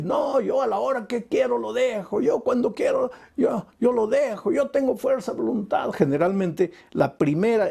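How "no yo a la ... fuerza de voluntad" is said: higher, lightly voiced boastful denial; mock addict's denial. Addict insists he can quit, mock boastful. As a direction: brush it off like a stubborn drinker.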